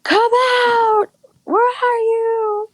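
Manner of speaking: high, drawn-out calling out; mock holler with long, stretched vowels; imitated drunken yelling. Drunk kid yelling for his missing friend, imitated calling. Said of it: "he was, like, yelling for his friend".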